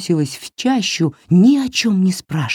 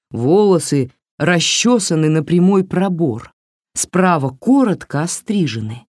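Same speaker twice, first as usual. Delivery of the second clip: ordinary delivery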